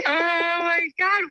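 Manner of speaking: high shriek of laughter into a slow oh my God; laugh, then drag out oh my God. Real, not acted. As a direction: burst out laughing in shock. Mother shrieks laughing at story.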